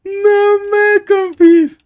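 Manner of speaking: very high, slow disbelief; stunned disbelief. Real, not acted. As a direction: stretch it out in utter disbelief. Exaggerated disbelief.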